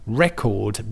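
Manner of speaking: Record said as REC-ord, the noun; strong first syllable in Record, weaker second; stress on first syllable. Shown as a demonstration. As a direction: hit REC at the start of Record. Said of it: "the stress on the first syllable".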